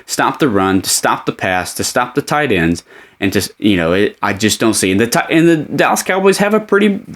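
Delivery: ordinary talk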